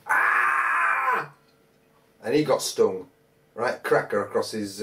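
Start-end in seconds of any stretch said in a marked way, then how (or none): 0.1-1.3 sharp high yelp of pain, imitated; mate's yelp. Retelling a mate stung on the back, sudden high yelp. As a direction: jump up into a pained cry as the mate. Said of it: "one of my mates go".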